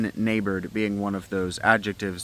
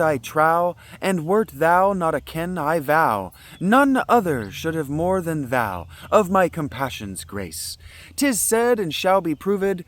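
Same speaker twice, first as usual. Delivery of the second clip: loud, high regal proclamation; 7 semitones higher, 6 dB louder, slower than usual. Acted. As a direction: speak as a proud sea queen. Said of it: "I wanna give her, like, a regal voice".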